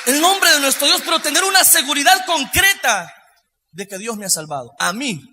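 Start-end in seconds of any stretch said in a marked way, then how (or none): 0.1-3.1 loud, rising exaltation from the pulpit; full-force preaching. Real, not acted. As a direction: preach it out loud, building higher.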